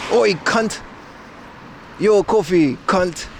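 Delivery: mock Australian insult; Aussie impression. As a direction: call it out in a put-on Australian accent. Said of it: "with Aussie".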